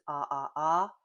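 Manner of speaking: ah held open like at the doctor. Shown as a demonstration. Teacher shows the open vowel, like at the doctor. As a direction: say ah, wide open mouth. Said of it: "just like at the doctor's office".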